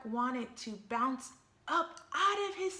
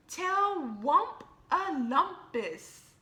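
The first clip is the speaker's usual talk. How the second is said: playful announcement; 4 semitones higher, 8 dB louder than usual. Acted. Announcing new game.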